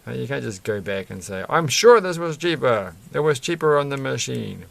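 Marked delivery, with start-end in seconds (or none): 1.5-4.6 mock protest. Pretend complaint to shop staff.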